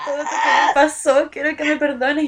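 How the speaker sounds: a hammed-up crying boy begging forgiveness; mock tearful. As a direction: act out his sobbing apology, half-hammed. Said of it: "entre lágrimas".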